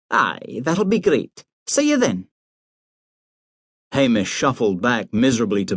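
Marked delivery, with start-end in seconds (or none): 0.1-2.3 mother's voice. A narrator's reading. Mother ends the call.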